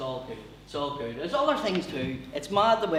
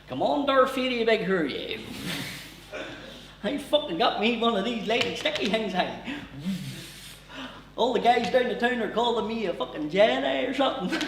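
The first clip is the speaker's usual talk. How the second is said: put-on higher-pitched broad Ballymena accent; Liam Neeson; 6 semitones higher than usual. Liam Neeson in Star Wars with his old voice, broad Ballymena accent. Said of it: "if Liam hadn't changed his voice".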